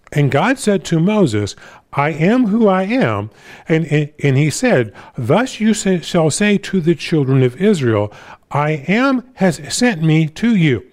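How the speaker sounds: slow, lifted, emphatic Bible reading; scripture reading. Acted. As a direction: read the verse out with deliberate weight. Reads scripture aloud to congregation.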